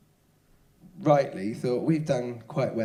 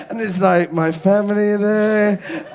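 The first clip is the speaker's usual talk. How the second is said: ordinary talk, nothing marked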